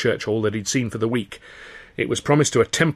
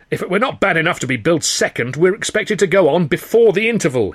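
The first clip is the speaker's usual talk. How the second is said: raised, indignant complaint, higher in pitch; fuming; 6 semitones higher, 3 dB louder than usual. A narrator's reading. Sid fuming to the Carno troupers about billing. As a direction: Sid airing his grievance to the troupe, fuming. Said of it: "he fumed".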